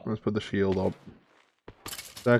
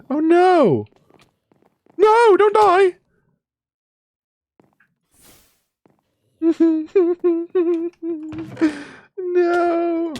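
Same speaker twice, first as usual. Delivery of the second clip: distressed cries; 4 dB louder, much slower than usual. Real, not acted. Pets dying in game, distressed cries.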